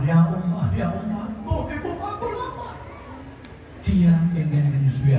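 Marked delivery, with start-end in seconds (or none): 0.1-2.8 a hint of a hungry baby, rising to high; imitating a crying infant, lightly; lightly voiced crying baby; mild baby wail. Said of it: "anaknya nangis".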